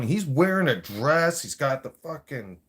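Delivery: ordinary delivery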